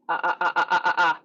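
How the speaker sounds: rapid staccato "ah" bursts from a closed throat; quick, clicky "ah" repetitions; glottal stops. Shown as a demonstration. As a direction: pop each "ah" cleanly on one pitch. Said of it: "It's called a glottal stop".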